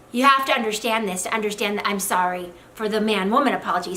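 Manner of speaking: ordinary talk, nothing marked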